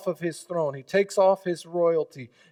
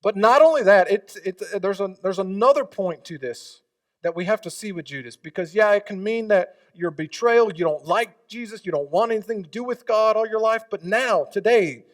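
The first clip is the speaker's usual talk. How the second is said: loud, pressing preacher's build; preaching at full force; 6 semitones higher, 8 dB louder than usual. Acted. Pastor urging his congregation, preaching loud. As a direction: drive it home to the congregation.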